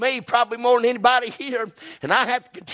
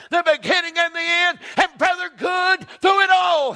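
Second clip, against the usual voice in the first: full-force preaching; 7 semitones higher than usual. Acted. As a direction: preach it out to the whole church. Preaching climax.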